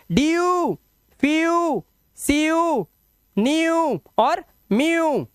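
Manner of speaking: डीयू, फीयू, सीयू high and stretched; drawn-out demonstration. Shown as a demonstration. Teacher models EW words like डीयू, high and drawn out. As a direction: hold the oo long in डीयू, फीयू, न्यू, म्यू. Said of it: "तो ईडब्ल्यू को क्या पढ़ेंगे आप?".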